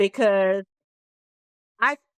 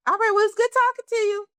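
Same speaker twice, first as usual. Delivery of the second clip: hasty goodbye; 11 semitones higher, faster than usual. Retold or imitated. Hurrying off the phone.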